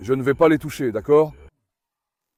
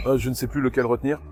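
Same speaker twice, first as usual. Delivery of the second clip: usual speaking voice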